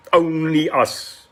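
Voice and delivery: ordinary talk, nothing marked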